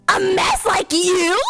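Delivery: high, slow sneer; mocking stepsister. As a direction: jeer at Cinderella. Stepsisters mock Cinderella.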